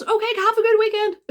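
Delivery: high, breezy imitation of the courier; mock-cheerful high sign-off; cheery goodbye. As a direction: chirp the farewell as if nothing happened. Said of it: "And he goes".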